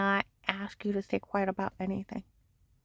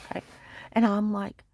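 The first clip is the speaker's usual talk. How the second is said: usual speaking voice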